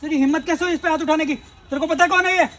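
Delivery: loud, high, indignant demand; outraged challenge. Acted.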